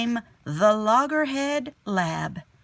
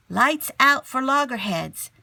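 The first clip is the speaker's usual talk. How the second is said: higher, louder slogan read; announcing slogan; 5 semitones higher, 6 dB louder than usual. A narrator's reading. Reading the children's fact sheet aloud, like a bold slogan. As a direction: read "Lights out for loggerheads" like a campaign poster.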